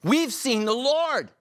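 high, slow, excited announcement; excited disciples. Retold or imitated. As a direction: proclaim it with wonder. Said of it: "they told him".

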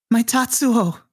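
high-pitched mock cry of joy; mother's yell. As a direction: call out high to a lost son, without much volume. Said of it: "she just yells".

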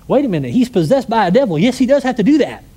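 loud, incredulous mock retort; indignant objection. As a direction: push back like it's obvious. Skeptical listeners objecting.

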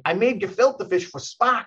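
put-on light New York accent on "I made gefilte fish for Spock". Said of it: "he's got this thick New York accent".

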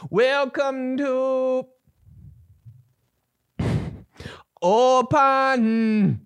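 high, very slow crooned line; rock singer voice. Pretend. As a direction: drag the words like a ballad. Singer voice bit resumes.

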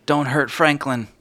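lightly voiced worried little boy; pleading child.

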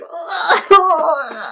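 high, wailing fake cry; hammed-up sob; mock sobbing. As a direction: ham up the crying. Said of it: "Samantha starts crying".

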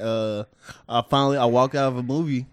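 usual speaking voice

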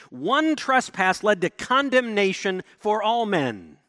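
loud, high scripture proclamation; preaching at full force. Acted. Preacher reading Romans to the church, loud and high. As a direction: proclaim the verses to the congregation.

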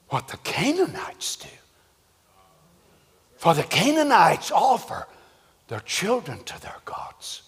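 hushed, frightened, halting whisper; fearful whisper. Acted. Isaac fearing his father will sacrifice him, frightened whisper. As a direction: stammer it out in quiet dread.

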